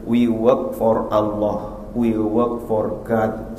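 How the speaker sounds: slow, measured English reply; slow retold answer. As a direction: say it slowly and firmly. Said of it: "Saya bilang". (pelan dan tegas)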